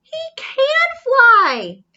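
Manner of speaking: high, loud and slow, bursting with delight; thrilled exclaim. Acted. Reading to a child, thrilled he can fly. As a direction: announce it like the best news ever. Reader reveals Norman flying.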